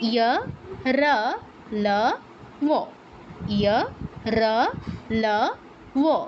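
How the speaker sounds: "ইয়, র, ল, ও" as ya, ra, la, wo; isolated ya, ra, la, wo for "ইয়, র, ল, ও", with gaps; semivowel demonstration. Shown as a demonstration. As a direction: recite "ইয়, র, ল, ও" one syllable at a time. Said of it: "চারটা বর্ণের উচ্চারণ কি কি পাচ্ছি আমরা".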